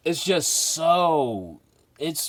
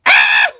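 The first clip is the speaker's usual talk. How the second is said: loud low yelp of fright; startled yell; 12 semitones lower, 15 dB louder than usual. Real, not acted. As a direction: yell out in shock. Scared by a skull-faced cover.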